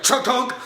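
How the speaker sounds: playful sound effect of a chair breaking; crunching chair noise. Retold or imitated. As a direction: mouth the sound of wood cracking. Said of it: "the whole recliner was like".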